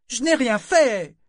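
high, loud, drawn-out scream of protest; cri. A narrator's reading. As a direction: scream it out indignantly, stretching the words. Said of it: "Elle avait crié ces derniers mots". (hurlement indigné et traînant)

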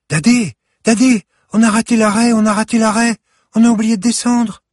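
put-on high, loud child's cry of alarm. A narrator's reading. Granddaughter warns grandfather they missed their stop, alarmed.

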